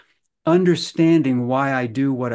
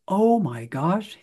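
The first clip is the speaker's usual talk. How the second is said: slow, higher amazed gasp of words; surprised realization; 6 semitones higher, slower than usual. Retold or imitated. Teen client realizing he is a narcissist, amazed. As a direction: let the realization dawn on you. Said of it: "he finishes and he says".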